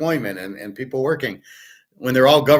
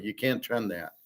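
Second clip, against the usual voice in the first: "You can't trend that" dropped much softer, falling away; muttered repeat; 9 dB quieter than usual. Real, not acted. Repeating his point to himself, dropped softer. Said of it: "repeats the phrase, second time dropped".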